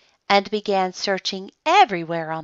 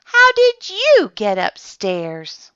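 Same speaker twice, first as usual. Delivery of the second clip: watchman asking; 8 semitones higher, 5 dB louder, slower than usual. A narrator's reading. Watchman questions the bear.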